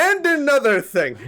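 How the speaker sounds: high, loud imitated shout of an arguing grandfather; mock yell. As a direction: bellow it across the house mid-argument. Grandfather yelling across the house.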